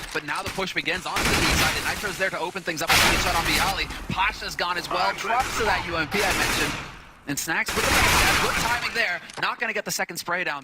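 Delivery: building, excited live play-by-play; esports caster. Real, not acted. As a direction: call the push as it happens.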